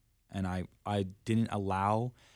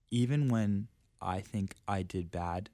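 usual speaking voice

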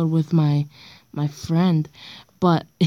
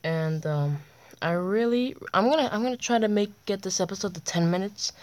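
quiet; 8 dB quieter than usual. Real, not acted. Late-night talk after water break.

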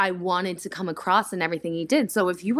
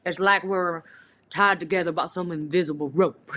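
imitating Garrett; 3 semitones lower than usual. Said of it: "tries to imitate".

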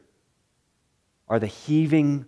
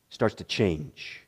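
sinks lower and softer to show the change; dropping low and soft; 7 semitones lower, 8 dB quieter, slower than usual. Shown as a demonstration. Preacher showing the mood darken, low and soft. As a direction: let the voice fall away, slow. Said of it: "the whole tone of the passage".

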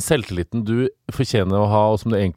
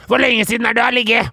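imitating boss; 11 semitones higher, 4 dB louder, faster than usual. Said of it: "Jeg bare".

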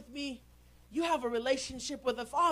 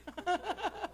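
short laugh; 6 semitones higher, 3 dB quieter than usual. Real, not acted. Laughs after calling wife liar.

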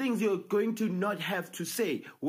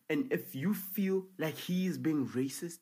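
ordinary talk, nothing marked

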